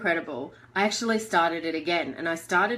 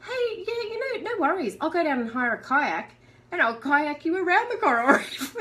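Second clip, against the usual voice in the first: much higher, breezy mock husband voice; imitating Jonathan; 8 semitones higher than usual. Husband Jonathan offers kayak tour, high breezy imitation. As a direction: toss it off like a relaxed husband. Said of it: "And Jonathan goes".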